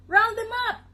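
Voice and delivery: high, loud farmer's call; called out high and urgent. A narrator's reading. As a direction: holler it out across the field. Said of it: "the farmer called".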